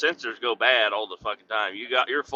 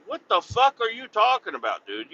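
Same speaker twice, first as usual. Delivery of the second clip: incredulous; 9 semitones higher than usual. Retold or imitated. Retold outburst at coworker.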